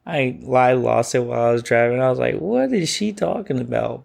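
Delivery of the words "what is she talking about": mock bafflement. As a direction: replay your baffled disbelief. Retells bafflement at mom's slang.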